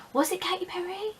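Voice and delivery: high, soft questioning; puzzled second-guessing. Real, not acted. Vlogger doubting her own first concert, puzzled second-guessing. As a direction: rise up high and hushed, doubting yourself.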